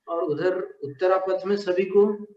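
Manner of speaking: usual speaking voice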